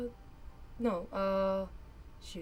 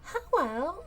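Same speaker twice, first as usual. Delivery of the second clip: cracked high voice; 10 semitones higher, 7 dB louder than usual. Acted. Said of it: "it just sounds very cracked".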